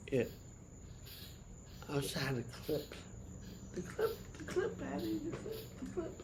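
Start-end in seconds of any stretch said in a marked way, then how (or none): 3.7-6.1 much higher, softer singsong voice; playful high-pitched repeating. Pretend. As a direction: chant it softly in a high little voice.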